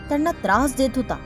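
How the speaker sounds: ordinary delivery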